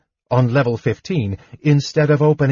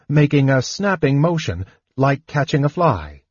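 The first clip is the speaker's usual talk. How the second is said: ordinary delivery, nothing marked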